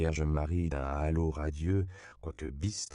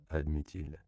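ordinary delivery

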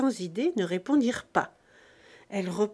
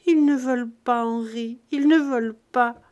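put-on louder, tearful plea like a spoiled child; sobbing, stammering; 2 semitones higher, 7 dB louder than usual. A narrator's reading. Childless wife pleading to her husband Henri, tearful. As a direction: stammer it through tears. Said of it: "avec une voix pleine de sanglots".

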